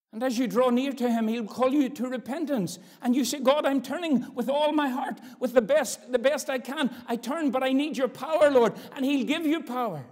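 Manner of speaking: loud, high urgent altar call; preaching at full force. Acted. Preacher urging sinners to repent, loud and high. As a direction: plead with them to come forward.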